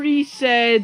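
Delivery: usual speaking voice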